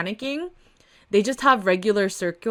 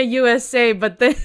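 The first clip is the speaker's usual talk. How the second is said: usual speaking voice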